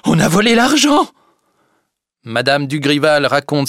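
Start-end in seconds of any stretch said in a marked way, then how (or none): 0.1-1.1 high, loud alarmed shout; wounded aunt crying out to the neighbours; frantic cry. Acted. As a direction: cry out that the money is gone. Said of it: "Elle crie".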